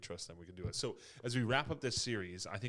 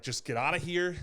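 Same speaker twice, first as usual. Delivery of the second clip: higher, louder exasperated dismissal; mock shouted get out; fed-up Pharaoh; 8 semitones higher, 8 dB louder than usual. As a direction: play Pharaoh throwing them out. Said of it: "Pharaoh's like".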